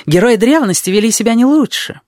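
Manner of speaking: high-pitched Jackal retort, climbing toward the end; objecting Jackal. A narrator's reading. Jackal objects to the narrator's disgust, high, affected protest. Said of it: "возразил мне шакал".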